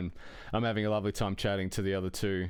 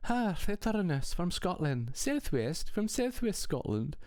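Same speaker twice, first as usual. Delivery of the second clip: imitating a soft female Scottish accent, much higher and slow; mock Scottish lilt, higher and slower; 9 semitones higher, slower than usual. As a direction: lilt it like a gentle Scottish woman, pitched well up. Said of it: "soft female Scottish accent".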